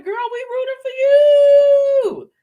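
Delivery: high, loud, slow cheer for her; root for her like a fan in the stands; drawn-out excited cheer. Retold or imitated. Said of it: "I'm just like".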